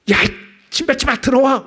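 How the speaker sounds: high, loud mock yell; preacher acting out a furious outburst, mock yell; pretend yell. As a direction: yell it like an angry man spat on. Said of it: "막 이런 소리".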